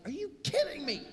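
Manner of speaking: high and loud, stunned; husband told of a warning, not a ticket, shouts in surprise; stunned disbelief. Retold or imitated. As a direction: exclaim like you just dodged a ticket. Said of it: "And I'm like".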